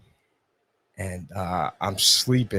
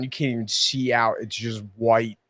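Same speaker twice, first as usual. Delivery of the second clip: ordinary talk, nothing marked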